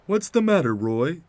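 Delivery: high, loud surprised question. Acted. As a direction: ask in high-pitched puzzlement. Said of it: "the two asked in surprise".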